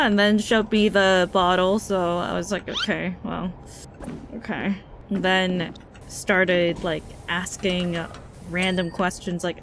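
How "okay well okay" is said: softer, hesitant, unsure how to respond; awkward quiet okay. Retold or imitated. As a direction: back off politely with a small voice. Uncomfortable reply to the stranger.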